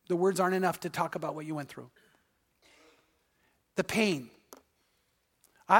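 soft, sorrowful compassion; tender and hushed. Real, not acted. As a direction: speak gently to the hurting. Pastor grieving over hurts.

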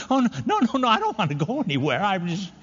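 put-on a very high, hasty little-boy backpedal; imitating little kid. Preacher as his boyhood self backing down, high and hurried. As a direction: squeak it out like a caught kid, fast.

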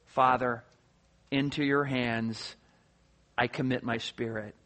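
very low, soft, halting phrases; intimate prayer. Acted. Jesus on the cross speaking to his Father, hushed and intimate. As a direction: pray it gently, pausing between phrases. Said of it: "the very intimate term".

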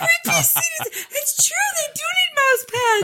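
high, loud hammed-up ecstatic pitchman; imitating Richard. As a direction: overdo his mouse pad rapture. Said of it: "the weirdest Richard moment".

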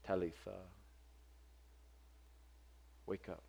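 put-on soft, hushed wake-up call to a child; tender, soft voice at a bedside; gentle. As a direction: gently rouse a sleeping girl. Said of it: "So gentle, so compassionate".

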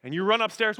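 ordinary talk

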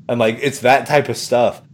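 ordinary talk, nothing marked

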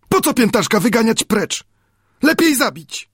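high, sincere and near tears; earnest Friday. A narrator's reading. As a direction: plead high with grave sincerity. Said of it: "ze szczerą powagą". (ze szczerą powagą, wysoko)

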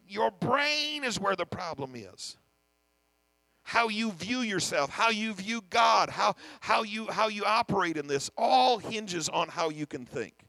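preacher pressing the point home; slow emphatic preaching. Acted. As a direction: lean on every phrase, slowly.

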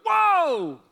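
high, loud amazed "whoa dude"; excited guy. Retold or imitated. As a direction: blurt it out like a stunned friend on a patio. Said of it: "some guy goes".

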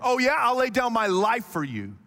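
hammed-up bravado; over-the-top boast. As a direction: blurt it like a bold boast. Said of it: "he gets extreme".

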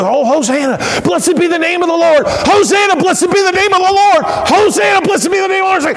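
high, ecstatic cries of praise; overwhelmed crowd. Acted. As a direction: cry out like a worshipper carried away. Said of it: "they got so overwhelmed".

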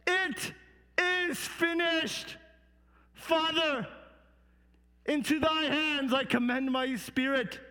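crying out. Acted. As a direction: let the last words ring out slowly. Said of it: "he cried".

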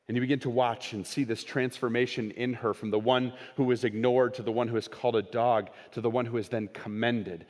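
ordinary talk

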